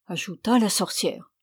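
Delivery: plain reading voice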